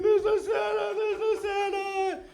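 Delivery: very high wailing child impression; exaggerated high-pitched tearful whine; mock crying. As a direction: wail it like a devastated little girl, hammed up. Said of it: "and she's crying".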